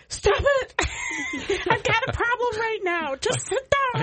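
high mock yell at a naughty kid; half-laughing high shout; retold yell at kid. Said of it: "and I'm like".

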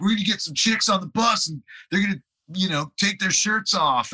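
high-pitched imitation of rowdy bandmates; mock excited hype. Aging rockers planning bus party, mock excited boast. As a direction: crow it like an over-eager party guy.

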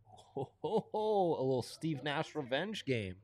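ordinary delivery

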